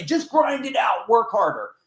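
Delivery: high, slow mock shout of a hype guru; sarcastic coach shout. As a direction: parody the empty motivational yell. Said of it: "If they're gonna say".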